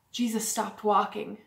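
usual speaking voice

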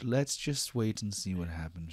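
normal talking voice

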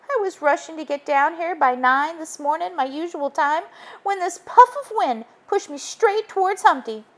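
high, small nervous spider voice; Spider's story. Acted. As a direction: Spider confessing to Joe and Muffy. Spider tells what happened.

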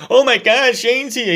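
high, loud mock fanboy squeal; mock gushing excitement. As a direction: ham up his breathless awe. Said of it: "I hate that Byron Saxton is like".

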